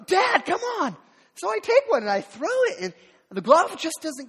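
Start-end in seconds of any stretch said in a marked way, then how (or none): 0.1-1.0 put-on high, loud whiny little-boy shout; impatient kid shout. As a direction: whine it at dad, fed up. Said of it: "you know".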